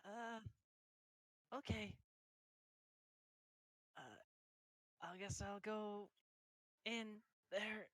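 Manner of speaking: soft, slow and unsure; a hint of a timid girl, slow; sheepish. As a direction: mumble it like a shy girl stepping forward. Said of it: "walks very sheepishly back".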